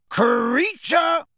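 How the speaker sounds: high, drawn-out yell of a name; loud call. Acted. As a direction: holler across the house. Sirius calling the elf louder.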